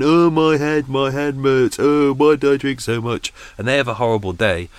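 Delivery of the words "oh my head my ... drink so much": mock hungover whining, higher and louder; groggy hungover moan. Mimicking hungover people on New Year's Day, mock moan. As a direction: imitate everyone nursing a hangover.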